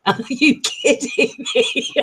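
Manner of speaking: "Are you kidding me?" repeated high and slow through laughter; laughing disbelief. Retold or imitated. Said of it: "He must have just thought".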